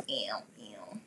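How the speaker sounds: breathy mock whisper. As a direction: whisper it teasingly. Teasing whisper after "juicy.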